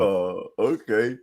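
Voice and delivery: slow, flat unbothered "Oh, okay"; deadpan shrug. Retold or imitated. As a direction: drawl it out like nothing fazes you. Repeating unbothered Duncan impression.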